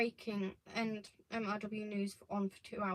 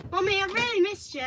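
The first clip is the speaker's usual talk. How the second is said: high, loud gush to mum; high-pitched, needy welcome back; effusive greeting; 8 semitones higher, 6 dB louder than usual. Real, not acted. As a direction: pour out how much you missed her. Gushes at mother on her return.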